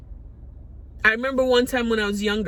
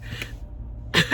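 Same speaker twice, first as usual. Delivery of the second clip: laughs; 6 dB quieter than usual. Real, not acted. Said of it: "bursting out in laughter".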